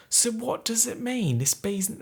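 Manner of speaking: bad British accent, pitched high; Graham Norton. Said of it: "Actually, no, he's British".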